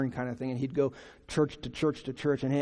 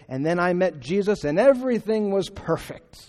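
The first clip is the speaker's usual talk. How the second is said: higher, louder, imitating a glowing testimony; mock triumphant testimony; 5 semitones higher, 7 dB louder than usual. Preacher imitating believers' happy-ending testimonies, mock triumphant. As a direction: build up an overly perfect conversion story.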